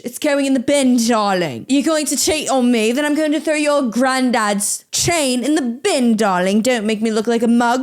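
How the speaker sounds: hammed-up British threat; mock British accent. British woman threatening her cheating man, mock British accent. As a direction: scold in a put-on British accent. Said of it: "Oh, we're British".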